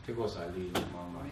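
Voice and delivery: soft, low, tender answer; tender reply. Retold or imitated. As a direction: say it gently to your mother. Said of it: "son answers dying mother tenderly". (respuesta tierna y suave a la madre)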